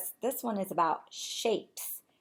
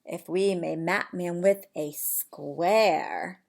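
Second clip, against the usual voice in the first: plain reading voice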